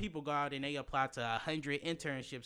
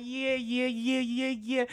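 high, loud eager yeahs; excited yeah yeah; 11 semitones higher, 8 dB louder, much slower than usual. Retold or imitated. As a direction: gush yes over and over. You eagerly accepting job.

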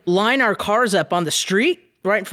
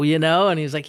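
ordinary talk

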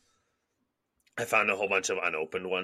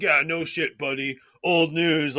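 mock commenters; 9 semitones higher, 6 dB louder, slower than usual. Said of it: "everybody in the comments was like".

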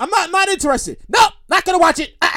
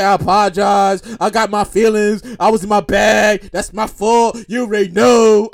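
lower, slower, slightly louder mock contrite voice; exaggerated mock apology; 6 semitones lower, 3 dB louder, slower than usual. Heckler apologizing on CNN, in a lower, slower mock voice. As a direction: ham up a lazy, half-hearted apology. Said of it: "he ain't say it like that, but, you know, I gotta put my emphasis on it".

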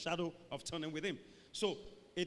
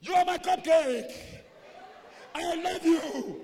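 high, loud mock declaration; problem shout; 10 semitones higher, 11 dB louder, slower than usual. Lover with a problem, shouting sweet words. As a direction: bellow endearments as if far away. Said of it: "there's a problem".

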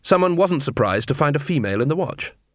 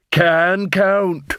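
raised-pitch troll voice; slow-witted troll; 7 semitones higher, 3 dB louder than usual. Acted. Troll tells his dwarf partner he can count, dull-witted. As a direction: insist like a simple troll.

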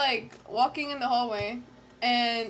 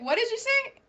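retold challenge; 10 semitones higher than usual. Retold confrontation with classmate.

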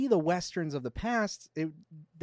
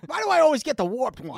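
high, whiny complaint; whiny governor voice; 9 semitones higher, 4 dB louder than usual. Retold or imitated. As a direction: whine it like a dim governor. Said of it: "And he's like".

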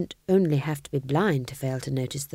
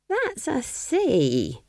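put-on higher, very slow old witch; Nanny correcting; 8 semitones higher, much slower than usual. A narrator's reading. Nanny Og correcting the king, slow and high. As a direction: correct him slowly. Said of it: "said Nanny Og".

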